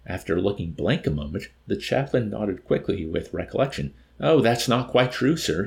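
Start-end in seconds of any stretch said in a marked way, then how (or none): 4.2-5.6 raised, louder, eager correction; eager explaining. A narrator's reading. Chaplain correcting the officer, eager explaining. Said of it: "he explained eagerly".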